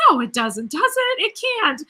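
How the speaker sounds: high-pitched mock alarm; mock shocked disbelief. Vlogger retells denying to the waiter, mock shocked. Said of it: "And I was like".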